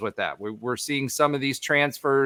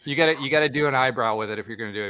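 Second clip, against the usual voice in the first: normal talking voice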